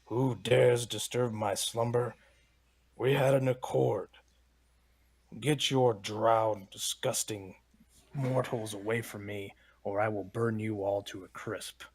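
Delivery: slow, booming dragon voice; a dragon woken from its slumber, rumbly. Acted. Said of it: "as low and rumbly as I can make my voice draconic".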